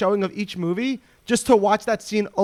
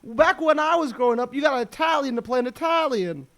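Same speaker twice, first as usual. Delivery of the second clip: lightly voiced old-time complainer; a hint of a grumbling old-timer, pitched up; 5 semitones higher than usual. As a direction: play at being an indignant old-timer. Parodies a grumbler from back then.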